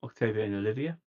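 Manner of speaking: normal talking voice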